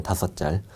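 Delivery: short-tongued "짤" with tch for the s of 살; childish lisp on "짤"; lisping baby talk. Shown as a demonstration. Teacher previewing the next mnemonic, lisped "짤". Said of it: "혀 짧은 소리 좀 내야 돼요".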